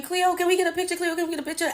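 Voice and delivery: high, excited fan shouting; screaming fans. Retold or imitated. Fans calling out to Cleo in the rain, high and eager. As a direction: mimic eager fans begging for a photo. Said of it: "The fans are screaming".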